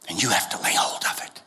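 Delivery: sudden unvoiced hush after the rope line; whisper. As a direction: drop to a breathy secret. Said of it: "sudden whisper in sermon".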